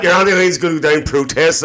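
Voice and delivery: usual speaking voice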